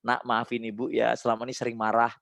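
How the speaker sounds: soft, low parent's apology; apologising to child. Shown as a demonstration. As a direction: demonstrate a tender sorry to your child. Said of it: "minta maaf".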